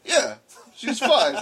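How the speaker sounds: sheepish laugh about his wife. Real, not acted. Laughing at his own fumble.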